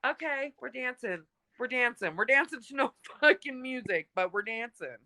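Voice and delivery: high, resigned, half-laughing; amused humouring. Retold or imitated. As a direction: go along with it, laughing at yourself. Humouring the mother while dancing.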